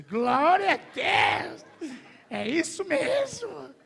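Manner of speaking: drawn-out, high, booming praise cry; over-spiritual shout. Retold or imitated. Preacher acts out his own over-spiritual reaction, hammed-up praise shout. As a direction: shout it slowly like a fired-up believer. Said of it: "eu já tava todo espiritual, já disse".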